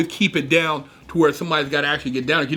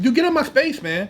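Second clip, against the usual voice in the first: higher, firm get-back warning; retold warning; 10 semitones higher than usual. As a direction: push him off verbally. Warning a stranger.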